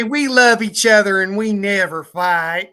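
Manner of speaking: feed the line in grandma's voice; grandma voice prompt. Retold or imitated.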